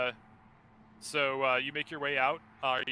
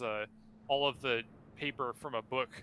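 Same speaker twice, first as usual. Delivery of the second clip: ordinary delivery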